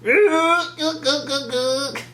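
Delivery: high, long creature honk with yelps; imitated alien war cry; Sand People cry. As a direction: whoop high, then break into short barks. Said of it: "that was a good impression".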